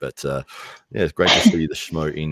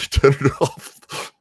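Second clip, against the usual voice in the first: laughing; 10 semitones higher, 5 dB louder, much slower than usual. Real, not acted. Laughing at own joke.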